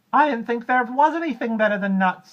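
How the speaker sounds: very high, loud chirpy monkey. Acted. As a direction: chatter cheerily at the croc. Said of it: "said Mugglewump".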